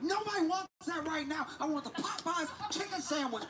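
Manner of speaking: high, squeaky mock whine; whiny customer. Chick-fil-A regular whining about Popeyes, high mock whine. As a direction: pitch it up like a spoiled fan.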